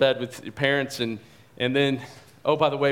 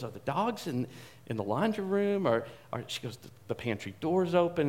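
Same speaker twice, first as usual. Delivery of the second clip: softer, worried questions; hushed worry; 3 semitones higher, 6 dB quieter than usual. Retold or imitated. Daughter whispering worries to dad, quiet and uneasy. Scared daughter hears noise.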